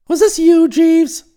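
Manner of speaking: high, loud dawning realisation; astonished suspicion. Acted. Employer realising his valet is behind the scheme, amazed. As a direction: put the sudden suspicion to him.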